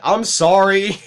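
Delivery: loud, high exclaimed sorry; mock apology. Former worker apologizing to an absent supervisor, exclaimed.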